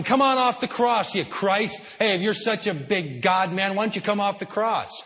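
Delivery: loud, high jeering shout; mocking crowd yelling. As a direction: taunt him from below the cross, loud and scornful. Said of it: "While these people are yelling".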